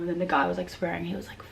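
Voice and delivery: usual speaking voice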